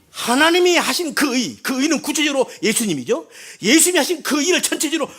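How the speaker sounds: high, loud, driving pulpit voice; preaching at full force. Acted. Pastor to congregation, loud soaring preaching. Preaching loudly about God's righteousness.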